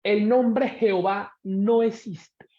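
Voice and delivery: slow, measured teaching statement; unhurried, emphatic delivery; slow and deliberate. Real, not acted. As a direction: spell it out slowly so nobody gets confused. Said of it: "lo voy a decir despacio para que no se confundan".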